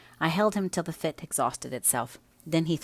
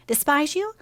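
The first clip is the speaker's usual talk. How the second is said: high astonished cry; shocked at the very idea; surprised; 13 semitones higher, 7 dB louder than usual. Acted. Cathy shocked at question.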